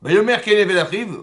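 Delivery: loud, high, insistent recitation; emphatic verse reading. Real, not acted. Teacher proving the text to the class, emphatic loud reading. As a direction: proclaim the verse forcefully. Reads verse aloud to prove point.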